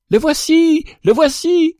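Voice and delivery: a whole forest hailing the bird's return; joyful shout. A narrator's reading. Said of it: "crièrent mille voix". (cris de joie aigus)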